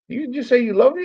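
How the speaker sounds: high-pitched incredulous question; mock disbelief. Brother questioning an unexpected I love you, high disbelief. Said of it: "he took it as a joke".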